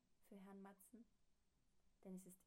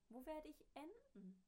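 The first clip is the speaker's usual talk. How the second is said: higher, anxious question; worried little man; 8 semitones higher than usual. Acted. Herr Matzen wondering where he will land, anxious high question. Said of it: "dachte der kleine Herr Matzen".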